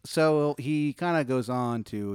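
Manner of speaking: ordinary talk, nothing marked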